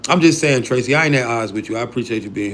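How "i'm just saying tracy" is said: ordinary talk, nothing marked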